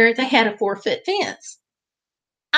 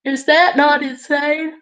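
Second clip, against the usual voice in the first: slow words stretched by a yawn; 5 semitones higher than usual. Real, not acted. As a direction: say it mid-yawn, drawn out. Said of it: "I'm yawning there".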